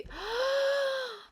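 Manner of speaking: high, drawn-out gasp of awe; a real gasp at something beautiful; stunned delighted gasp; long gasp. Real, not acted. Sees the Hourglass palette.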